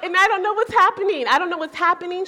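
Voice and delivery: excited disbelief. Real, not acted. Speaker telling the congregation she was amazed, laughing loudly.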